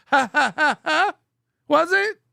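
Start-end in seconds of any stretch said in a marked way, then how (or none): 0.1-1.1 mock laugh in spaced syllables; imitating his own laugh. Host imitating his own laugh, mock laugh. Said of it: "Mine was".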